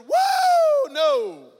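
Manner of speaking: jolted, backing away in disbelief; startled recoil. Retold or imitated. Young man sees everyone in cowboy hats, shocked whoa.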